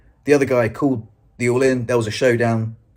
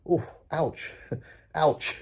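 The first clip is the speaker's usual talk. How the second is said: wounded wince; 3 semitones higher, 5 dB quieter, much slower than usual. Retold or imitated. Wincing at player's blunt reply.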